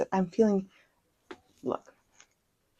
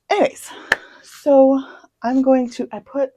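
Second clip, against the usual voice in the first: much higher and louder burst; excited; 8 semitones higher, 8 dB louder than usual. Real, not acted. Restarting her plan to viewers, high and excited. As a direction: jump in high and loud.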